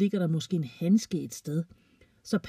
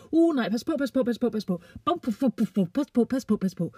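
panicky little dog; 5 semitones higher than usual. A narrator's reading.

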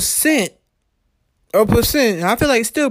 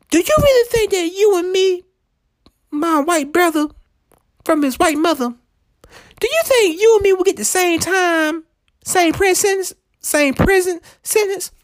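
high-pitched, pleading disbelief; incredulous challenge; 9 semitones higher than usual. Retold or imitated. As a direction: press a disbelieving question on a coworker. Challenging the coworker in retold argument.

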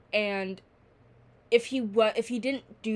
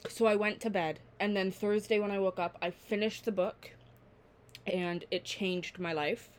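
normal talking voice

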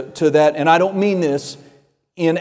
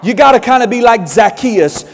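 usual speaking voice

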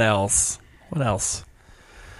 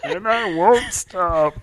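high, slow cartoon alien voice; smug little Martian; Marvin the Martian; 6 semitones higher, much slower than usual. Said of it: "Marvin the Martian impression".

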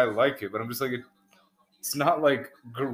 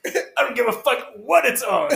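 high-pitched mock outrage; grumpy old man; 12 semitones higher, 4 dB louder than usual. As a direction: snap back like an irate old homeowner. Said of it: "Half Sack as an old grumpy".

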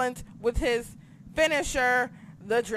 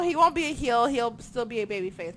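usual speaking voice

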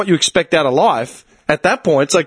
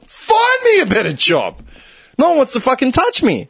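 exasperated retort; 7 semitones higher than usual. Acted. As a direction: mock-desperate comeback, stretched out. Acted exasperated retort to advisers.

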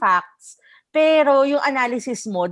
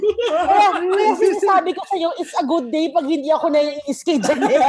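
laughing; 7 semitones higher than usual. Real, not acted. Exasperated laughing complaint.

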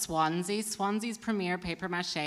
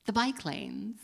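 open-ended small talk; 4 semitones higher, 4 dB quieter, slower than usual. Retold or imitated. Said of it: "keep it open-ended".

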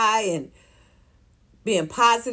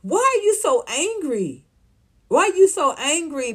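mock incredulous question; 6 semitones higher than usual. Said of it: "I'm like".

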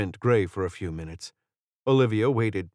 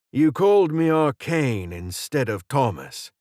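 higher-pitched British character voice; slight British accent; 5 semitones higher, 4 dB louder than usual. A narrator's reading. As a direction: point it out in a light British accent. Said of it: "he said with a slight British accent".

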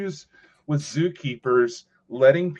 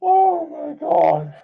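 high, drawn-out, very slow disbelief; stunned oh my God; 14 semitones higher, 6 dB louder, much slower than usual. Real, not acted. Host reacting to the guest's alligator joke, real shock. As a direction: stretch it out, genuinely floored.

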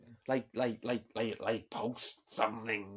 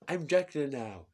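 put-on louder, higher proud Trump accent; Trump impression; 6 semitones higher, 9 dB louder than usual. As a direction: Trump shows off a mask. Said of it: "I can't get out of his accent now".

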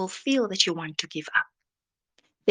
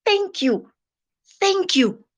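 grateful thanks; 10 semitones higher, 4 dB louder than usual. Pretend. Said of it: "to say".

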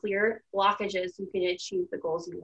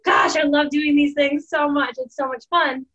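retold joy, shouted higher and louder; excited shout; 5 semitones higher, 6 dB louder than usual. Host retelling her joy at grandma's house, shouted loud. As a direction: burst out with delight like jumping around.

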